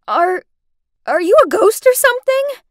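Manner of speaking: high, loud, stammered question; frightened stutter. Acted. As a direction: stumble on the first word, alarmed. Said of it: "I stuttered".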